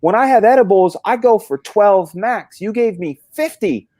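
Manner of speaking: high, loud outraged complaint. Retold or imitated. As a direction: blast your friend for the overdose, high and loud. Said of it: "I said".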